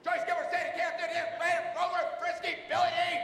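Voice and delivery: loud calling out a string of names; mom calling names. Retold or imitated. Mom calling out for her kid, loud. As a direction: yell the names like a mom hunting for the right kid. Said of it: "My mom would go through all the kids' names".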